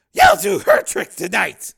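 high, loud, harsh order; commanding pimp. Acted. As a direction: order her with no room for argument. Pimp orders another girl.

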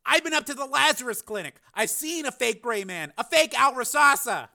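high, insistent pleading; urgent persuasion. A narrator's reading. Frank convincing his brother, urgent persuasion. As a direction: press each point home urgently.

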